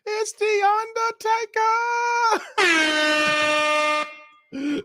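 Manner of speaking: slow drawn-out announcer call with a high start; wrestling announcer impression. Streamer to chat, hammed-up ring announcer voice. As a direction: stretch Undertaker out like a ring announcer. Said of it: "Rome keep doing this here".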